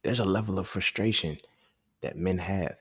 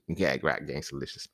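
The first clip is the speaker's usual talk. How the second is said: ordinary talk, nothing marked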